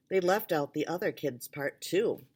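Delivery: plain reading voice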